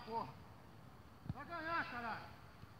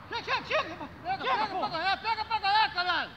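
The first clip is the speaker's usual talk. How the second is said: loud, higher, laughing while yelling; laughing shout; 5 semitones higher, 15 dB louder than usual. Real, not acted. Urging teammates during match.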